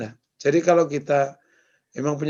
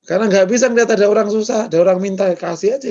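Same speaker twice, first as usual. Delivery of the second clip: higher-pitched, hurried self-justification; acted protest; 5 semitones higher, 4 dB louder, faster than usual. Speaker voices a too-generous person explaining the empty wallet, acted protest. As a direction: plead your case in a quick, raised voice.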